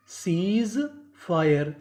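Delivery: सीज ... fire, each word drawn out slowly; slow teacher's breakdown of सीज fire; broken into parts. Shown as a demonstration. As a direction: stretch सीज, pause, then fire. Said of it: "टुकड़ों में तोड़ तोड़ के पढ़ें".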